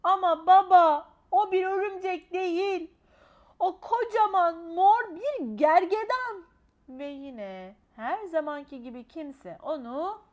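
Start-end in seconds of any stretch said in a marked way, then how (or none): 0.0-6.4 high, slow voice breaking into tears; pleading child. A narrator's reading. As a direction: beg dad to listen, nearly crying. Child pleads, unheard by father. (ağlamaklı, yalvaran küçük kız)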